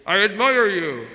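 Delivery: slow, high, loud call; echoing answer. Acted. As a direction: stretch it out like a mountain echo. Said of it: "the voice answers".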